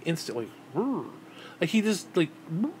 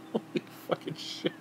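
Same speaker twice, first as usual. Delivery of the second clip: breathless laugh; 2 semitones higher, 9 dB quieter than usual. Real, not acted. Shocked laughter at story.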